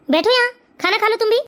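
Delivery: ordinary talk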